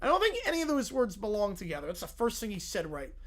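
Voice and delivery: usual speaking voice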